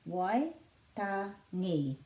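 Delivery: Waitangi drawn out slowly as "wai... ta... ngi"; syllable-by-syllable pronunciation. Shown as a demonstration. Teacher breaking down the name for learners, slow syllable-by-syllable pronunciation. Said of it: "and this is a flattish T".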